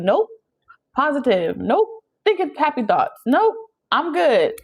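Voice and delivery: high, sing-song mock positivity; forced cheer. Mocking a friend who keeps saying nope, forced cheer. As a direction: brush everything off in a falsely bright voice.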